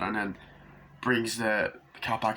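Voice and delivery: usual speaking voice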